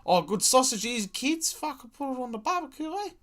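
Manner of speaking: very high, fast mock voice; hammed-up cook offering sausages to the kids. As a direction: squeal it like a proud cook serving guts to the kids. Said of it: "and was like".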